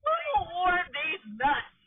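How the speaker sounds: slow, loud mock outburst; mocking shout. Real, not acted. Friend loudly mocking her friend's global warming claim. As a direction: drag it out and shout it down.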